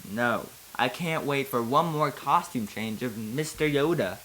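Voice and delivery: normal talking voice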